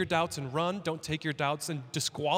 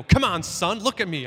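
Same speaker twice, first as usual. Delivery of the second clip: loud, exasperated mock scolding; mock rebuke; 3 semitones higher, 8 dB louder than usual. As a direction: bark it like an impatient father, half in jest. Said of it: "Smack him upside the head and go".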